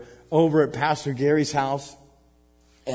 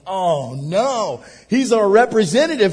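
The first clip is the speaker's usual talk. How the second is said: raised, louder mock alarm; 6 semitones higher, 8 dB louder than usual. Pastor recalling their panic, mock alarmed cry. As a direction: sound the alarm in the retelling.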